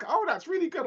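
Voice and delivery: high, gushing praise; mock-impressed, high. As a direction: gush like you just heard it. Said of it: "have a different tone".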